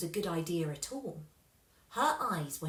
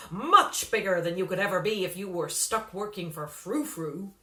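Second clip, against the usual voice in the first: slick manager; 6 dB louder than usual. A narrator's reading. Slingsby voiced by narrator.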